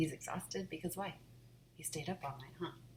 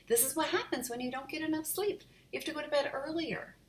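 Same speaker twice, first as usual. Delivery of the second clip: lecturing mom; 11 semitones higher than usual. Acted.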